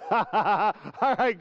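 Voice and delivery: real laughter through the words; chuckling after the laugh drill. Real, not acted. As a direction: still laughing as you speak. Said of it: "that is so weird".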